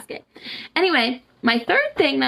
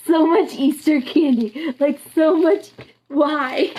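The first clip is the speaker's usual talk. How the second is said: high, loud, slow gushing; gleeful exclaim; 6 semitones higher, 4 dB louder, slower than usual. Real, not acted. Showing viewers the huge candy bag, gleeful and loud. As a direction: gush over the huge haul.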